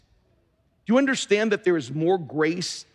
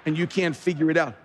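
usual speaking voice

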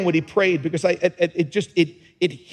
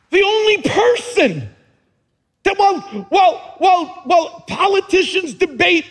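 higher, louder impassioned sermon peak; preaching at full force; 8 semitones higher, 5 dB louder than usual. Acted. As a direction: build the altar-call climax. Preaching climax about Jesus.

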